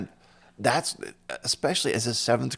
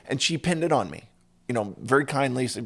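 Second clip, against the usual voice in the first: usual speaking voice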